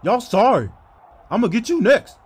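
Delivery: normal talking voice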